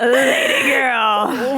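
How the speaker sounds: drawn out very slowly, loud and high, half laughing; mocking drawl. Real, not acted. Repeating the email's wrong podcast name to her cohosts, loud slow mock. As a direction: stretch it out in disbelief.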